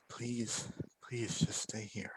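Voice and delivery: very soft, near-whispered begging; hushed plea. Acted. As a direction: plead under your breath, slowly. Embers pleads with the captured kobold.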